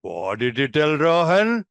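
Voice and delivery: drawn-out, teasing question rising at the end; playful mischief-finding. Shown as a demonstration. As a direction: ask a peer slowly, as if catching mischief. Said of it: "similar age finding some mischief".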